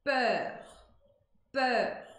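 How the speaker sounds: "Peur" with the E of égua and rounded lips; French peur. Shown as a demonstration. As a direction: say "Peur" with an open French vowel, like "pér". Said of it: "como que fica essa primeira palavra aqui?". ("Peur" pronunciado com E aberto e biquinho)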